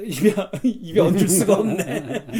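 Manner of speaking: too amused to say it; real laughing. Real, not acted. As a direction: laugh through the words. Laughs over unsayable swear word.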